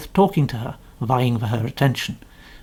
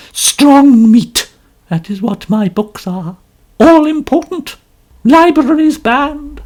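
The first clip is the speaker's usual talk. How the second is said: much higher, louder, slow declaiming of her title; theatrical novelist; 9 semitones higher, 8 dB louder than usual. A narrator's reading. Mrs Otterbourne declaiming her book to Poirot, slow and grand. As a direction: savour every word of the title.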